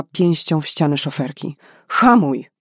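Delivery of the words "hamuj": sharp, loud and high; shouted order. Acted. Karolina to the driver, loud shouted order. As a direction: bark it through the cab wall.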